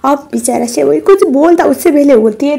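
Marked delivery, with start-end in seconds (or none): none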